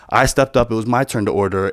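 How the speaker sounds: ordinary delivery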